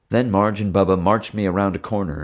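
ordinary delivery, nothing marked

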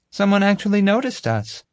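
put-on very high girl's voice; impressed girl. Girl surprised that someone noticed, impressed. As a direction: pitch it far up with wonder.

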